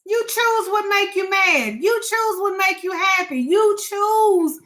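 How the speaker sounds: telling viewers they have the power; preaching. Real, not acted. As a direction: proclaim it like a sermon. Preaching about the power to choose.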